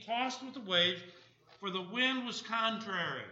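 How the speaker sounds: scripture reading. Acted. Preacher reads the storm story to the congregation, emphatic scripture reading. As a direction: project the verses with preaching force.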